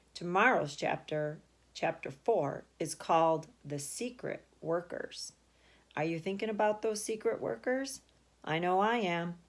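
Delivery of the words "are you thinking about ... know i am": ordinary delivery, nothing marked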